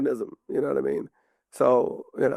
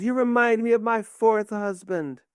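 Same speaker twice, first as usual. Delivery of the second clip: put-on slow, higher-pitched older woman; 6 semitones higher, slower than usual. Older woman to a stranger on the bus, slow high voice. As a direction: voice an older lady, drawn out.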